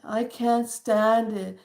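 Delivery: drawn-out, put-on complaint; exaggerated gloomy griping; mock depressed. Shown as a demonstration. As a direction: act fed up, dragging the words out. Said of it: "all of a sudden the energy just dropped".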